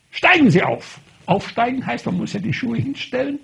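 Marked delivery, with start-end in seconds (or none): 0.1-1.0 louder, higher curt order; imperious command. Retold or imitated. Doctor ordering the priest up, imperious command. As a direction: bark it like a haughty doctor. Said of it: "Da sagt sie ganz herrisch".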